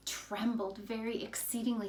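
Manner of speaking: plain reading voice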